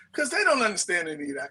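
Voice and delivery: ordinary talk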